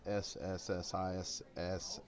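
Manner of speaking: long hissing s sounds, slow; drawn-out s like a snake. Pretend. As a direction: hiss the letters like a snake. Spelling Mississippi with hissing.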